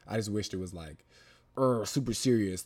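normal talking voice

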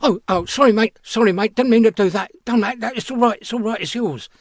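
high, loud, hasty backing down; flustered apology. Retold or imitated. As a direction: imitate a man hurriedly apologising, pitch jumping up. Startled stranger backing off, apologising.

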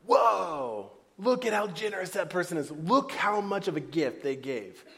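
mock-awed "Whoa", playfully impressed; awed gasp. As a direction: gush over a big gift, lightly hammed up. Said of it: "people are like".

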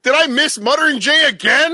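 very high, loud incredulous question; hammed-up outcry at missing out; mock dismay. Feigns dismay at missing the guest.

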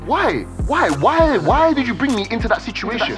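desperate why. Retold or imitated. Pleading question to mother.

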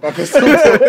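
loud laughing shout; yelling across the room. Retold or imitated. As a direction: call it out through laughter.

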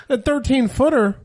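high, loud incredulous echo; mock disbelief. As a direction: act aghast at the casual answer. Shocked reply to uncle's casual remark.

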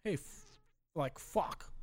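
soft, tentative English accent. Shown as a demonstration. Friend asked for English accent, hesitant soft English attempt. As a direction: try out a quiet English accent. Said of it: "in English accent".

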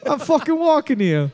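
high, hammed-up Boston tough guy; Boston accent impression. Host mocking a Boston accent to the co-host, hammed-up Boston. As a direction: bark it like a Boston cabbie. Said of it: "has a Boston accent".

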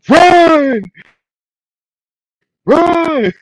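high, drawn-out yell of one word; screamed warning. Retold or imitated. Storyteller as Joel screaming at them to run, high yell. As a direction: imitate a desperate shout to flee. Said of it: "as he's screaming at the".

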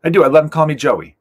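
ordinary delivery

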